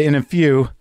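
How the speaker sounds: ordinary delivery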